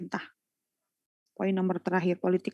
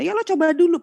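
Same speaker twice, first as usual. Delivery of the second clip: much higher, louder snappish retort; annoyed interviewer; 9 semitones higher, 6 dB louder, faster than usual. Retold or imitated. Interviewer irritated at a candidate who refuses to try, loud snap. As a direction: play the irritated interviewer pushing back. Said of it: "yang ada sewot".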